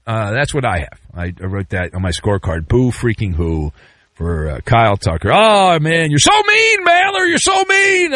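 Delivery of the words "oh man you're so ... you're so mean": very high whiny shout; hammed-up squealing protest; mock complaining listener. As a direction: wail at the host like a wounded fan.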